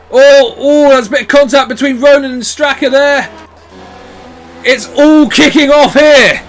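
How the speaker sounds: rising excitement on track; excited. Real, not acted. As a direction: call it out, thrilled. Chaos on track, raised voice.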